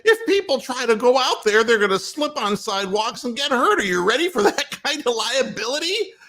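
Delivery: high, loud challenging retort; heated retort. Retold or imitated. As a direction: needle the president, half laughing. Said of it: "I said".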